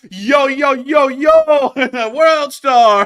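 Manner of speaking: high, loud hype yelling; hyped bystander yelling with a laugh; imitating a hype guy. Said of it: "that guy was like".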